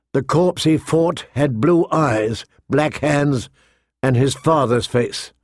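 plain reading voice

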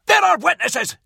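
very high, loud outrage; outraged. Acted. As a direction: insist in shrill outrage. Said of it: "Merrin insisted, outraged".

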